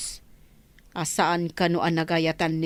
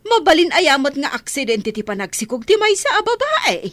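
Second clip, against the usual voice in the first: higher, louder angry retort; scolding; 7 semitones higher, 5 dB louder than usual. Acted. Nana angrily rejecting the accident excuse, scolding. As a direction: scold her bitterly. Mother angry about the pregnancy.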